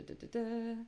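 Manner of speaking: normal talking voice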